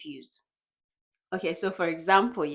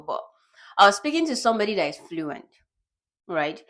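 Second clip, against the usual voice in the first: ordinary delivery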